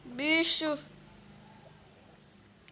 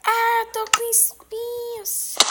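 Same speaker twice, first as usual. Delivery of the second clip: slow, high, loud wail; whining wail; 8 semitones higher, 5 dB louder, much slower than usual. Real, not acted. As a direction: wail in pain. Hurt by thorns, slow wail.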